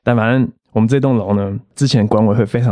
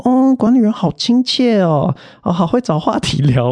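gushing neighbours; 9 semitones higher than usual. Retold or imitated. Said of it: "所有人都说".

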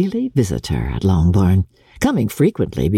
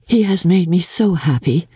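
higher, lightly voiced young woman, happy; Jane's happy voice; 7 semitones higher than usual. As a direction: lift into Jane, glowing with joy. Jane speaks in read novel.

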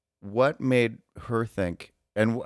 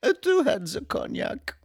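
silly voice. Pretend.

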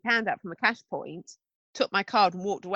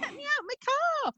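very high, small pleading voice; squeaky pretend distress; mock trapped plea; 4 dB quieter, much faster than usual. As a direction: imitate someone calling from inside a car. Acts out the trapped person.

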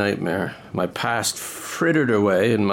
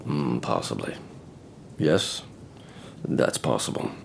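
put-on low drunken mumble; 11 semitones lower, 8 dB quieter than usual. Drunk, admitting quietly.

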